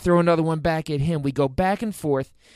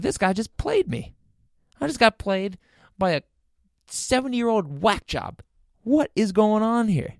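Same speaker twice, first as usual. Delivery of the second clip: exasperated disbelief; 7 semitones higher than usual. Retold or imitated. Realizes he was beaten.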